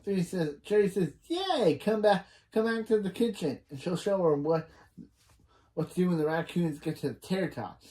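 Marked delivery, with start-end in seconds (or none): 1.3-3.6 high, bubbly imitation of an excited friend; lightly acted chirpy welcome; perky retold cheer. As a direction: pitch the cheer up high, then usher them in. Acting Cherry's cheerful invitation.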